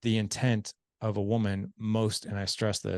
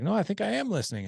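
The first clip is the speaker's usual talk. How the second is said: defensive guy; 11 semitones higher, faster than usual. Retold or imitated. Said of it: "He's like".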